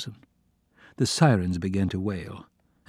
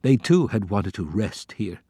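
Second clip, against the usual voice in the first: ordinary delivery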